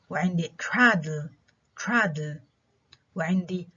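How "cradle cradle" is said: "cradle" modelled twice, stressed on CRA; first-syllable stress. Shown as a demonstration. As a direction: hit the first syllable of "cradle", then repeat. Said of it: "examples of stress on the first syllable".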